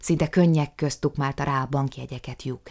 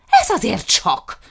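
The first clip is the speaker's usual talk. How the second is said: louder, higher outraged cry with weight on Ez; raised, slow indignant protest; indignant shout; 6 semitones higher, 9 dB louder, slower than usual. A narrator's reading. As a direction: hurl it out in fury. Jukrics raging about lost dogs.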